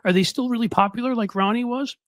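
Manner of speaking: high-pitched mock worried voice; timid older politician. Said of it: "these older folks in the Reagan crouch".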